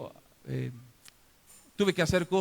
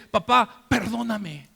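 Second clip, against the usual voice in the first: loud, raised plea for forgiveness; forceful, pleading outburst; impassioned plea; 3 semitones higher, 6 dB louder, much slower than usual. Acted. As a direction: cry out to your father. Same plea, now cried out to father.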